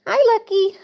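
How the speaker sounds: bright high squeaky hello to the kitten; high kitten greeting. Real, not acted.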